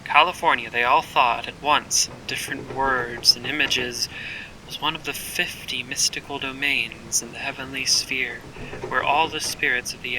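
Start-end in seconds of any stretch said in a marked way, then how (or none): none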